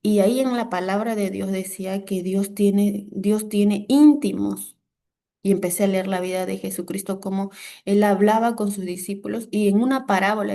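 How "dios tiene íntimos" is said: slow and loud, leaning on "íntimos"; a slow preacher's punch on "íntimos"; emphatic revelation. Acted. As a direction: drive home "Dios tiene íntimos" word by word. Preacher stressing key revelation.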